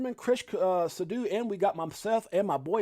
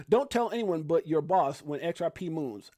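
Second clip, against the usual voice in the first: ordinary talk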